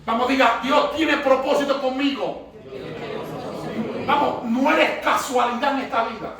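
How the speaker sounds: rousing sermon call, congregation repeats; fervent pastor leading a call and response; preaching. Acted. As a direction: lead the church in a spoken declaration.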